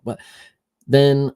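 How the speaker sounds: normal talking voice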